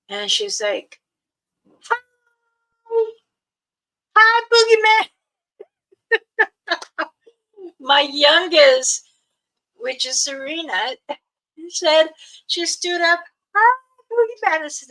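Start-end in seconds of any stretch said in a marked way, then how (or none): 1.8-5.1 put-on much higher, louder, childlike hello; little girl greeting. Toddler calling out to the creature, high and loud. 6.1-7.1 put-on high giggle in a child's voice; a little kid's laugh; childlike giggle. Toddler giggling after greeting the creature, high giggle. 13.5-14.6 put-on much higher, louder, childlike hello; little girl greeting. Toddler calling out to the creature, high and loud.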